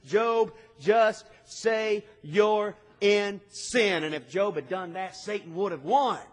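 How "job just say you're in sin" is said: slow, louder, every word pushed hard; pressing accusation. Retold or imitated. Friends demanding Job confess, in a slow, louder, pushing voice. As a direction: lean on each word to wring a confession out of him.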